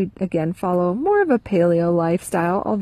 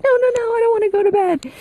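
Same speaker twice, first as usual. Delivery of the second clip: imitating a kid's much higher, louder whine; whiny protesting kid; 4 dB louder, faster than usual. Mom imitates a toddler refusing bedtime, high whiny protest. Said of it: "they're like".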